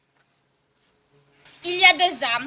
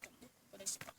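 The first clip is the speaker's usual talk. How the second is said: whisper; 6 semitones lower, 19 dB quieter, faster than usual. Real, not acted. Mocking the interviewer, under breath.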